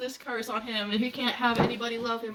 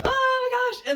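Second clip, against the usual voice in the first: excited gush; 13 semitones higher, 5 dB louder, slower than usual. Real, not acted. Gushing over a book scene.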